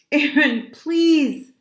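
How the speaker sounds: high, loud plea; desperate appeal to a friend; pleading. A narrator's reading. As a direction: beg urgently, stretching the words. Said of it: "I pleaded".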